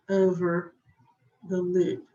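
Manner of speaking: ordinary talk, nothing marked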